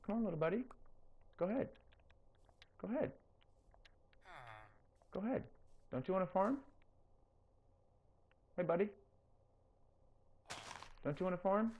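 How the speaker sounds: sweet-talking the villager into farming, high and soft; coaxing a pet. Real, not acted. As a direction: coax him gently like a shy pet.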